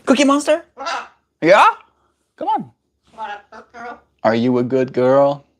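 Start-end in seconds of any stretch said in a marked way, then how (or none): 0.1-2.7 talking to a pet. Real, not acted. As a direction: chirp it at the bird. Baby talk to the bird.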